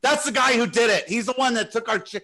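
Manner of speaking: a hint of the angry woman telling her husband; accusing wife. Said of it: "I heard her say to her husband".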